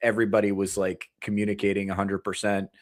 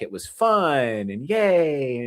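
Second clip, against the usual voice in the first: mock cheerful; 5 semitones higher, much slower than usual. Mocking boomer nostalgia.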